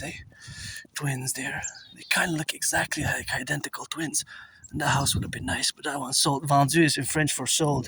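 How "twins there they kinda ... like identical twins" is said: whispered aside; hushed whisper. Real, not acted. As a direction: breathe it out, no voice.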